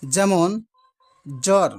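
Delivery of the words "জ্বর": জ্বর said as jor, with no b sound; clear word demo. Shown as a demonstration. Teacher to students, modelling জ্বর as jor without the b. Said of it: "জ্বর শব্দে এখানে কিন্তু ব ফলার উচ্চারণ নেই".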